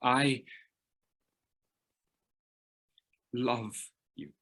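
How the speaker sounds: soft, halting I love you; a guarded man finally saying love; hesitant, hard to say. Shown as a demonstration. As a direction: struggle to get it out. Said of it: "the guy says the L word".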